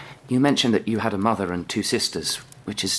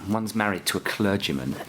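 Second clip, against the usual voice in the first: ordinary talk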